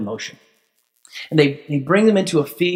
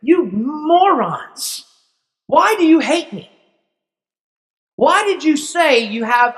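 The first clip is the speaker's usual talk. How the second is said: higher-pitched pleading father's cry; anguished father Jacob; 8 semitones higher than usual. Retold or imitated. Jacob begs his sons not to take Benjamin, higher and pained. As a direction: plead like a heartbroken old father. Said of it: "And Jacob's like".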